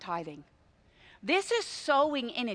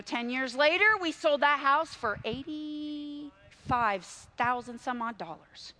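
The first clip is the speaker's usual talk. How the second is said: ordinary talk, nothing marked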